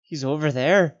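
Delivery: high, loud creature cackle; wild, cackling creature voice. A narrator's reading. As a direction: crow it in a gleeful cackle. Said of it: "he cackled".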